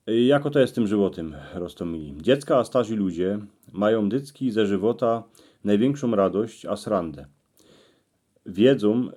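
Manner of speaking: ordinary delivery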